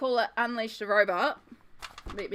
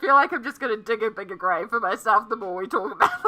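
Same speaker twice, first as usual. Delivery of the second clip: laughing; 6 semitones higher, 11 dB louder than usual. Real, not acted. Embarrassed laughing.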